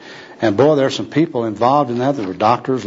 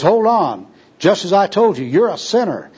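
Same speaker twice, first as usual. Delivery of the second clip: high-pitched, overriding insistence; insistent preacher; 7 semitones higher than usual. Acted. Gospel worker argues with protesting tramp, insistently. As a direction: talk over his objection, pitch raised.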